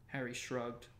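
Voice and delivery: usual speaking voice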